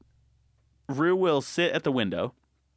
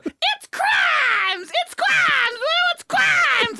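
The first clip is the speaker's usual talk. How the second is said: very high, loud hyped-up shriek; drawn-out falsetto shout of alarm; high-pitched frenzy; 6 dB louder, much slower than usual. Retold or imitated. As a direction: shriek it to send the dog wild. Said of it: "the higher pitch my voice gets".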